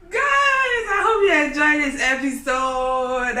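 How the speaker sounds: high, loud laughing call; laughing shout. Real, not acted.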